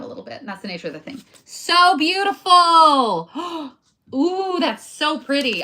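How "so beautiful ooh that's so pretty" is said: loud, high, drawn-out admiration with a gasp; gushing delight. Real, not acted. Crafter admiring her own piece, gushing with delight. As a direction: gush over it, stretching the words out.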